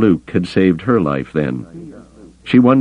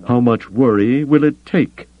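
higher-pitched, demanding question; pointed demand in a raised pitch; 7 semitones higher than usual. A narrator's reading. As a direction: demand an answer, pitch lifted. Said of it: "he demanded".

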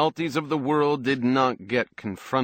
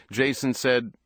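ordinary delivery

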